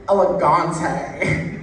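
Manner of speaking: "elegante" in an American accent, slow, with a long drawn "gahn-tay". Shown as a demonstration. Comic demo of "elegante" in an American accent, slow. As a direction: anglicize "elegante", stretching the middle vowel. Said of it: "if you say it with an American accent".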